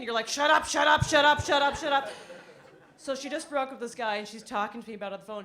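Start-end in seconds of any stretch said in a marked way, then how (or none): 0.3-2.1 loud, high, rapid-fire repetition; frantic silencing. Retold or imitated. Daughter blocking out her mother's sex life, frantic. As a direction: drown out the unwanted news, loudly.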